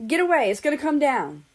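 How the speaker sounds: high, loud warning yell; urgent shout. A narrator's reading. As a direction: shout a warning across the chaos. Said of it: "came a shout from somewhere behind".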